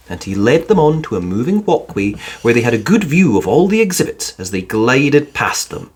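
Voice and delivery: ordinary delivery, nothing marked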